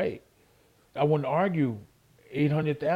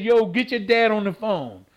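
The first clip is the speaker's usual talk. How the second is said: louder, higher ordering; angry demand; 5 semitones higher, 5 dB louder than usual. Retold or imitated. Boxer orders the promoter's daughter to get her dad, angry. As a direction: demand the boss, fuming. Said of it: "So I started cussing".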